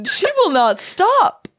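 slow, high exasperated voice; exasperated drawl. Acted. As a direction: stretch it out, fed up. Mocking the relentless woman.

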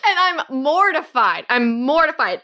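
normal talking voice

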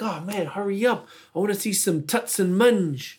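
impatient Kiwi mate at the bucks night, keen; Kiwi bloke. Retold or imitated. Retelling the Kiwi guy in the taxi, eager New Zealand accent. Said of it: "the guy's like".

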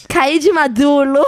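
put-on slow toddler voice, "maduro" said as "madulo"; Valentim. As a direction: announce it like a small boy after a tumble.

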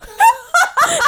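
a sudden high burst of laughter. Real, not acted. Laughing at the failed demo.

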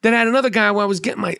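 usual speaking voice

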